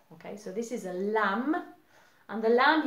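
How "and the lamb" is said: ordinary talk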